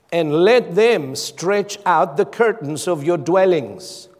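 slow, emphatic Bible reading; measured preacher's declamation; scripture proclamation. Acted. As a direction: proclaim each phrase deliberately, with long pauses. Reads scripture aloud emphatically.

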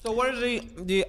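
mumbled through a mouthful of noodles; mouth full. Real, not acted. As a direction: talk while chewing, words muffled. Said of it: "If I sound mumbled".

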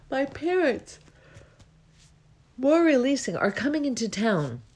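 talking through a yawn; words stretched by a yawn. Real, not acted. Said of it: "I'm always releasing when I'm yawning".

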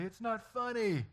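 put-on high, drawn-out kid protest; slow, high-pitched mock indignation; imitating indignant kids. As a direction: mimic a child whining in protest. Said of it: "my boys get in trouble".

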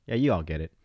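ordinary talk, nothing marked

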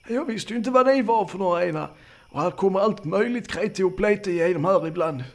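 put-on high-pitched elderly countryman; flustered old man. Old man explaining to campers why he wandered, flustered.

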